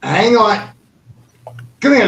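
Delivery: loud, high, repeated interruption; calling out. Real, not acted. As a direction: cut in loudly to get their attention. Calling out to halt Chris.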